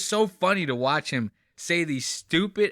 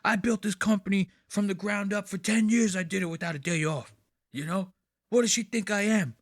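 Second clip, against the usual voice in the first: mocking Dave; 7 semitones higher than usual. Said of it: "he even went to say it".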